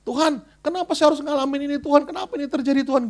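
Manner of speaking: high, lightly whiny prayer; complaining prayer. Retold or imitated. Struggling believer asking God why, mild whiny lament. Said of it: "Komplain sama Tuhan".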